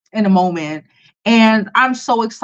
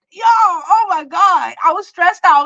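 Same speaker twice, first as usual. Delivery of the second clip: relieved exclaim; 9 semitones higher, slower than usual. Real, not acted. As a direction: let out the relief. Relief at the extra day.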